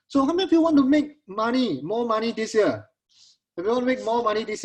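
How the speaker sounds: high, loud hype question; rally the crowd like a salesman; excited. Real, not acted. Host pumps up the audience.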